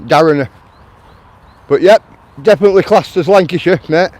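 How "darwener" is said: "Darrener" said as locals do, like "Darren-uh"; local pronunciation. Shown as a demonstration. Repeating what locals call it, "Darrener" in local pronunciation. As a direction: drop the w, say "Darrener" ending in uh. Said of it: "That's what we call it".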